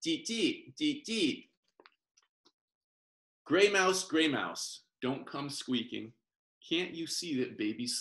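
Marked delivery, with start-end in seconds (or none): 0.0-1.5 high squeaky mouse deet; little mouse squeaks. Shown as a demonstration. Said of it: "this is the sound a mouse makes". 3.4-4.9 calling out. Acted. Calling to the mouse.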